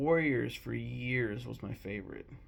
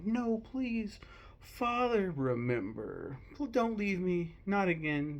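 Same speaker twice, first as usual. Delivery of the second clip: higher, mock-tearful begging; pleading Simba; 7 semitones higher, slower than usual. Simba begs his father not to leave, higher and pleading. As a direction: beg him not to go, a touch of tears.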